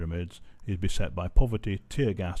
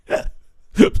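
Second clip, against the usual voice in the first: loud unvoiced laugh; burst of breathy laughter; 12 dB louder than usual. Real, not acted. Vlogger reading about bears, bursting into laughter.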